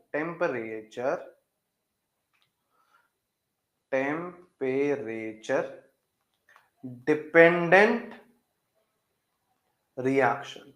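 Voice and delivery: very slow, one word at a time with long pauses; word by word dictation. Shown as a demonstration. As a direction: spell out "temperature", then "dependent", then "reaction", each held. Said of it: "same phrase, word by word".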